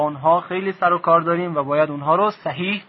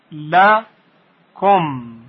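«لکم» broken into «ل», pause, «کم»; syllable by syllable; much slower than usual. Shown as a demonstration. Teacher repeating the split of «لکم» for learners, syllable by syllable. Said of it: "ل در یک بخش".

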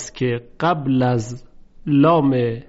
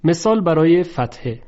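ordinary talk

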